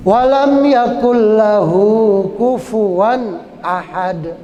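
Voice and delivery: slow recitation, distinct n in "kufuwan ahad"; clear tanwin. Shown as a demonstration. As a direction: sound KUFUWAN's final n clearly before ahad. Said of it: "tanwin ini harus dibaca jelas".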